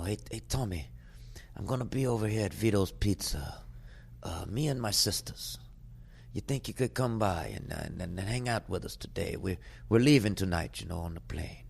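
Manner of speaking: soft, lightly done Australian Godfather voice; a hint of a laid-back Aussie Godfather; Aussie accent. Host imitating his Australian friend's invitation in an Aussie accent. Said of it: "he talks slow ... the Aussie accent".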